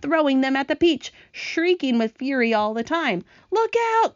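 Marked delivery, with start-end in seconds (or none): 3.5-4.1 high-pitched urgent cry; cried warning. A narrator's reading. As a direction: call out a sudden warning. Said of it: "cried James".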